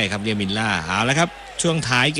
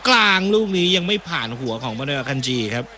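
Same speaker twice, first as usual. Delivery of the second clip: building, louder football call; live play-by-play; 4 semitones higher, 5 dB louder than usual. Acted. Commentator calls a Canada attack for viewers, rising play-by-play.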